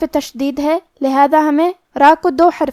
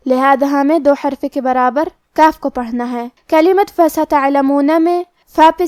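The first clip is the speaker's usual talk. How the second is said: ordinary talk, nothing marked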